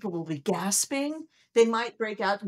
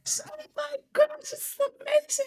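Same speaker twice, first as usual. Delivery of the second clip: much higher, thrilled squeal; ecstatic whoa. Retold or imitated. Scientist seeing the rings, squealing with delight. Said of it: "I'm yelling".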